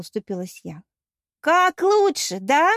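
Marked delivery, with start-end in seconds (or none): 1.4-2.7 high, loud indignant echo; furious retort. A narrator's reading. Goddess answering her friend's defence, loud furious retort. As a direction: throw the words back angrily.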